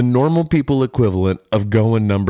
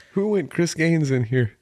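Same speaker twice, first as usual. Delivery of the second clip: a hint of a giggling question; laughing inner voice; 5 semitones higher, 3 dB quieter than usual. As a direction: ask it half-laughing. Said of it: "I'm gonna immediately think".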